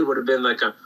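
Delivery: normal talking voice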